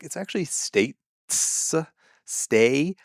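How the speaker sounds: "states" drawn out slowly, its sounds pulled apart; mock correction. Mimicking a nitpicker fixing someone's accent, mock-pedantic correction. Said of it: "I would never correct them and be like".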